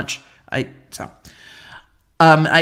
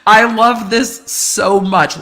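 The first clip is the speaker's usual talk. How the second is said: delighted; 4 semitones higher, 6 dB louder, much slower than usual. Real, not acted. Delighted by viewer's comment.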